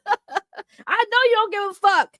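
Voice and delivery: high, slow, bubbling with laughter; laughing tease. Real, not acted. Streamer teasing an indifferent viewer, laughing.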